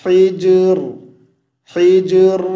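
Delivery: हिज्र with a bouncing, echoed j; qalqala on jeem. Shown as a demonstration. Teacher drills the jeem, हिज्र with a bouncing qalqala. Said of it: "जी पर क़लक़ला करेंगे".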